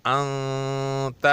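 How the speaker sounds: انت with the nun hidden in the nose, stretched long; nasal ikhfa. Shown as a demonstration. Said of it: "دوبارہ سنیں". (انت میں نون کا اخفا، ناک میں لمبی آواز)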